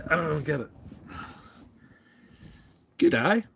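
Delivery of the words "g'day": cheery high Aussie greeting; amateur Aussie accent; Australian accent attempt. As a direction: try on an Australian g'day. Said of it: "Do I sound like I'm from there?".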